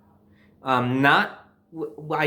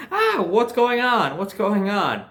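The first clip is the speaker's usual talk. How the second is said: alarmed; 6 semitones higher, slower than usual. Retold or imitated. Panicked at the smoke alarms.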